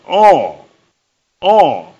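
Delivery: loud single-word exclamation; shouted sermon emphasis; emphatic cry. Acted. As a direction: punch the word out to the crowd. Preacher hammering one word.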